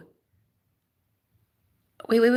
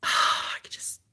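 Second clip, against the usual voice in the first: breathy hushed whisper; 10 dB quieter than usual. Real, not acted. Telling viewers about last night, in a whisper. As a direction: whisper it like a secret.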